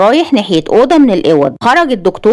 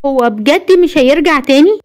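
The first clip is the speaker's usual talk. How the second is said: higher, timid female-character voice; fearful question; 6 semitones higher than usual. A narrator's reading. Frightened woman asks her protector, higher worried voice. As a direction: ask it anxiously, pitched up. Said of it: "وقالت بخوف".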